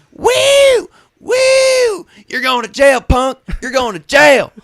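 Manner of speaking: high, loud yelling cop wannabe; screaming woo then you're going to jail; mock shout. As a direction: holler it like a triumphant trailer-park vigilante. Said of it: "he gets out and he goes".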